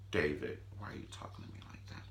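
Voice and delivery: hushed, hurried disbelief; mock wounded whisper. Wife to the husband who turned on her, mock wounded whisper. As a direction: whisper it as if taken aback.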